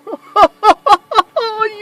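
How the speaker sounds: high, loud, delighted giggle; genuine Santa laugh. Retold or imitated. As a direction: laugh with pure joy as Santa. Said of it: "and then I go".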